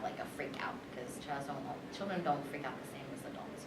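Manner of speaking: hushed whisper aside. Real, not acted. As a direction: drop to a whisper.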